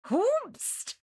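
high-pitched comic disbelief; mock astonishment. Co-host reacting to the daughter reveal, mock astonishment. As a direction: hoot it high, playfully scandalised.

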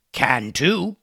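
evil pirate captain. A narrator's reading. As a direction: snap back with villainous relish. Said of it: "an evil smile".

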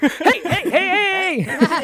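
high laughing hey hey to get heard. Real, not acted. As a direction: break in laughing to hush the table. Calling for attention over laughing table.

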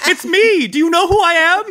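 high, loud, giddy owl; high excited owl calling out; owl mime voice. Acted. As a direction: squeak it out like a proud owl. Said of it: "sort of be like".

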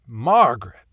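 loud, high desperate yell; belting her name. Acted. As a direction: belt the name out into the cave. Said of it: "I was belting her name out now".

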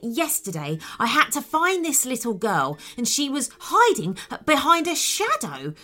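upbeat cartoon dog; eager dog. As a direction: invite the kids to play, keen and bouncy. Dog character speaks.

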